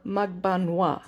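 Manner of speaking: Magbanwa with broad ah vowels, three syllables; three-syllable pronunciation. Shown as a demonstration. Showing viewers the Filipino pronunciation, three syllables. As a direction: say Magbanwa slowly as mag-BAN-wa. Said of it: "Almost like three syllables, not four".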